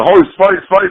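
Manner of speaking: high, loud call of fight. Retold or imitated. Running out of the bar, calling fight loudly. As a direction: yell it to rally the band.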